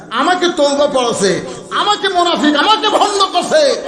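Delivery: high, climbing declamation; impassioned retelling. Retold or imitated. Umar listing the charges against himself, in rising declamation.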